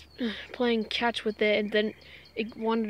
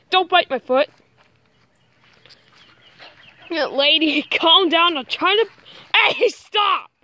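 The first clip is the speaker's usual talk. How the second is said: raised, high-pitched protest; scolding a dog; 6 semitones higher, 9 dB louder than usual. Real, not acted. Vlogger tells dog not to bite foot, loud scolding. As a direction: fend off a nipping dog loudly.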